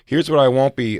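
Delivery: ordinary talk, nothing marked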